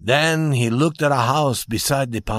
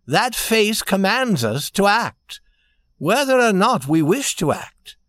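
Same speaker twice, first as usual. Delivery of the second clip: higher, emphatic princely pronouncements; prince warning urgently; 8 semitones higher, 3 dB louder than usual. A narrator's reading. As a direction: warn him off the clock, with grand conviction.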